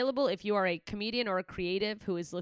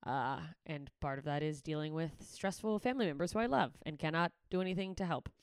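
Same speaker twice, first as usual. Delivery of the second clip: ordinary talk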